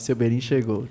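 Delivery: lightly voiced sticker catchphrase; sticker voice imitation. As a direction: say it like the meme sticker. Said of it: "igual aquela figurinha que a gente tem lá no WhatsApp".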